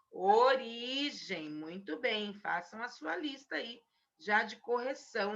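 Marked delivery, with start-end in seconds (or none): 0.1-1.5 origem spelled out slowly, syllable by syllable; slow dictation. Shown as a demonstration. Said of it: "Como é que a gente escreve?".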